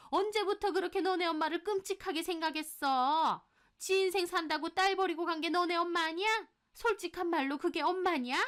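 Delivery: high, accusing mother-in-law voice; haughty, high-pitched tirade; scolding mother-in-law. Acted. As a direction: berate the daughter-in-law indignantly.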